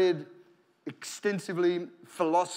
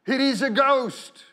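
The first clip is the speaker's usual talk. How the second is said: higher, louder, slow frightened cry; 5 semitones higher, 6 dB louder, slower than usual. Acted. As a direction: cry out at a ghost in fear.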